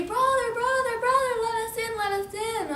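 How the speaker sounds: very high, urgent calling; frantic storybook plea. Acted. Pigs flee to third brother, high frantic pleading. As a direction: beg in play-acted panic.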